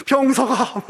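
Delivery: crying out a name in grief; anguished calling out. Retold or imitated. Egyptian neighbor crying out for their eldest child, wailing. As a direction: call the name in a mock wail. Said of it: "울부짖는 소리가".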